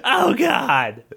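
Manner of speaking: hammed-up pained scream; mock scream. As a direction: cry out like it hurts, hammed up. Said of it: "screamed the warrior".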